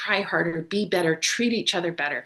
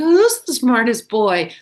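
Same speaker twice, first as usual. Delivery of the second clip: higher, louder cooing pet voice; pet talk voice; 4 semitones higher, 5 dB louder than usual. Shown as a demonstration. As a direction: coo at the dog like a proud owner. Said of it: "Like that".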